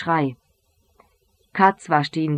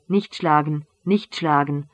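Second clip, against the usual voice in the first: plain reading voice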